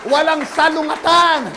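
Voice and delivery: loud, building sermon cadence; a pastor's fiery sermon climax; preaching at full force. Real, not acted. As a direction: preach it to the back row.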